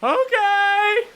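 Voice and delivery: high squeaky gull okay. Acted. Gull agreeing to try again, high squeak. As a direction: chirp it like the gull.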